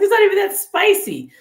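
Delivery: high, loud mock protest; indignant disbelief. Speaker protesting to her tearing eye, high loud disbelief. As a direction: squeal it out in disbelief. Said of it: "I was like".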